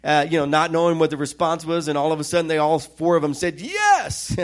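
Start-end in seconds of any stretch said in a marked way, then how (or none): 3.6-4.4 very high, mock-eager cry; eager yes. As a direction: leap way up in pitch, imitating eager answers. Four people eagerly answer at once.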